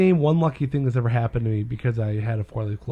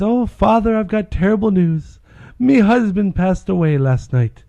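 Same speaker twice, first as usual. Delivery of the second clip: higher, louder mock-distressed wife; acted tearful widow; 5 semitones higher, 5 dB louder than usual. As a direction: play the grieving wife, hammed up. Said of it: "and she's in tears".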